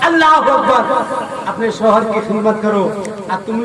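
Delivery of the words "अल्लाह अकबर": slow, drawn-out sermon cadence; preacher addressing the women at the gathering, drawn-out and loud; full force preaching. Acted. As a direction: preach to the crowd, stretching the key words.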